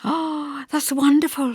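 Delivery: put-on high, excited young woman; bubbling over with disbelief and joy; eager Hester. A narrator's reading.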